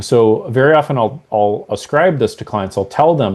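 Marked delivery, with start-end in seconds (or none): none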